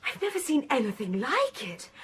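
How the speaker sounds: normal talking voice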